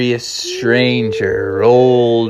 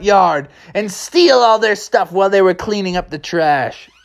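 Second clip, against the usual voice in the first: criminal voice; 10 semitones higher than usual. Acted. Criminal Kevin voiced high.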